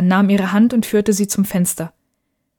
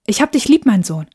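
called after him; 3 semitones higher than usual. A narrator's reading. Mother calls after departing son, fond call. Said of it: "rief sie ihm nach".

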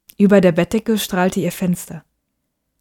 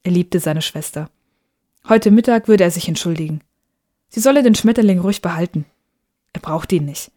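usual speaking voice

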